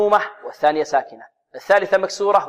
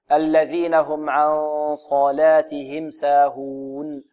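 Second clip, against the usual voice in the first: slow, measured tajweed recitation; Quran recitation; much slower than usual. Shown as a demonstration. Teacher modelling the verse for students, measured recitation. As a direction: recite it slowly with drawn-out long vowels.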